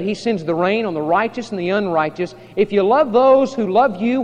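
loud, driving sermon delivery; full-force preaching. Acted. Preacher quoting Jesus to a congregation, forceful. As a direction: preach the scripture with rising force.

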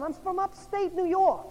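high, drawn-out mock New York accent. Newcomer tells the group where he is from, mock New York accent. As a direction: answer slowly and loudly as a New Yorker. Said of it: "His accent gives him away".

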